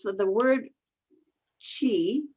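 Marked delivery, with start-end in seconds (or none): 1.6-2.2 qi said as "chee"; pronunciation demo. Shown as a demonstration. Explaining to the host one pronunciation, qi said "chee". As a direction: give qi a "ch" opening. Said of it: "is one pronunciation".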